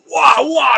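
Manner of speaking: high mock squawking; mock goose cry. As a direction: imitate an angry goose flapping in your face. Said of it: "is going".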